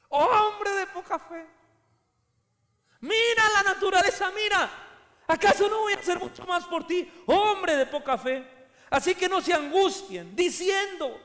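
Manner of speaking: high, loud preaching cry; fiery preaching. Acted. Preacher crying out to the congregation at full force.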